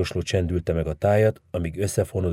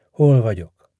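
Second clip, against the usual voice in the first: startled question; 5 semitones higher, 6 dB louder, slower than usual. A narrator's reading. Patient wakes up confused.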